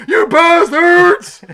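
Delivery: slow, high, very loud roar of outrage; mock outraged yell. Imagining Sammy bursting out the front door, mock outraged yell. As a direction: yell it like a thwarted villain.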